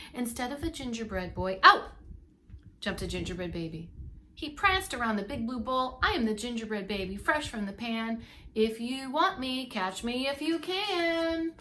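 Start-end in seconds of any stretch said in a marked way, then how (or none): 1.6-1.9 a sudden loud pop on the word; sudden loud burst. A narrator's reading. Baby bursts from oven. 6.0-11.5 put-on taunting gingerbread baby. Gingerbread baby's taunting rhyme.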